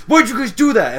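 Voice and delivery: high, loud acted complaint; mock indignant shout. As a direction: demand an answer in mock outrage. Angry Applejack confronts the pranksters.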